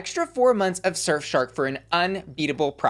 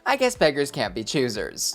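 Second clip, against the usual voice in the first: usual speaking voice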